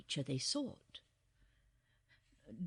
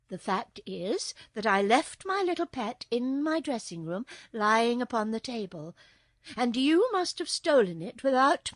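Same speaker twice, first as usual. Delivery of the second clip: mildly accusing, louder and higher; stern princess; 4 semitones higher, 6 dB louder than usual. A narrator's reading. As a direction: lay out the charge with restrained indignation.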